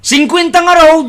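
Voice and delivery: a high, loud indignant retort. Acted. Arguing over the real price. (napigsa ken nangato a sungbat)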